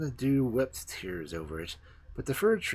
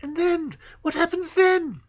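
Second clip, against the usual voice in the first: much higher, louder eager question; trembling eagerness; 8 dB louder than usual. Acted. The fir tree begs the sparrows for more, high and eager. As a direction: burst out eager to know. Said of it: "trembling in every limb with eagerness".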